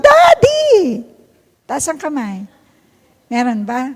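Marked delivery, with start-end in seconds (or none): 0.0-1.0 put-on high, wailing child's cry of "Mommy!"; crying child calling. Imitating a crying child calling for his mom, high wail. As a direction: wail it like a hurt kid. Kids crying call for dad.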